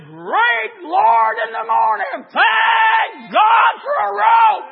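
high, full-force preacher's shout; shouted thanks. Acted. Preacher voices Joel grateful for the rope from a burning house, shouting. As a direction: cry it out, drawing out the last words. Joel's imagined thanks for rescue.

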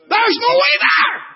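high, loud, slow preacher's cry; preaching at full force. Acted. Preacher crying out to the congregation about hell. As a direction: ring it out to the whole room.

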